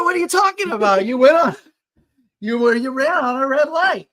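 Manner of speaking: high, loud protesting voice; mock indignant self. Driver protesting to the girl, high and indignant. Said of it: "I was like".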